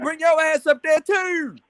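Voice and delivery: high, slow mocking Baltimore accent; strong Baltimore accent. Imitating the Baltimore rapper's taunt in Baltimore accent. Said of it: "He said".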